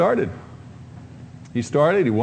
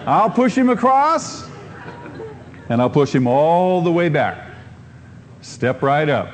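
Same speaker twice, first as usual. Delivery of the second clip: snake oil seller. Retold or imitated. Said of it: "He says".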